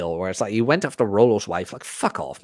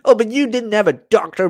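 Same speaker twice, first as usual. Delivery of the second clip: mock critic; 10 semitones higher, 4 dB louder than usual.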